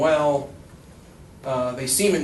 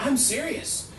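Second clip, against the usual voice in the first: high-pitched, softer insistence; earnest protest; 11 semitones higher, 6 dB quieter, slower than usual. Retold or imitated. Professor to laughing colleagues, earnest protest. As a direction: protest it high and a little quieter.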